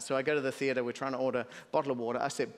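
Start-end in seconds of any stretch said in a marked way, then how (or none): none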